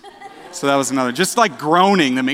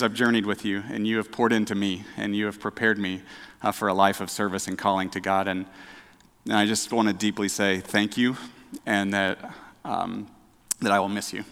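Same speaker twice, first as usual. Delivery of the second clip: emotional farewell; 6 semitones lower, 8 dB quieter than usual. Real, not acted. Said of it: "getting emotional".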